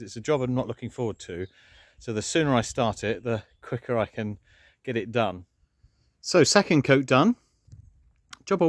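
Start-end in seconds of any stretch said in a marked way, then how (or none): none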